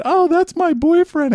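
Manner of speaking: put-on high-pitched, drawn-out girly voice; put-on high female voice; mimicked woman. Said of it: "They said".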